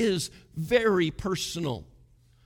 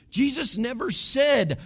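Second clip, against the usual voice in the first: forceful preaching; 6 semitones higher than usual. Real, not acted.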